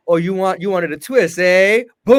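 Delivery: loud, cocky challenge; sassy taunt. Retold or imitated. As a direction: throw down the gauntlet, loudly. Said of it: "They're like".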